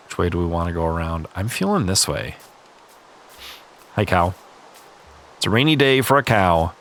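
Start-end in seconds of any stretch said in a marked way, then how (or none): none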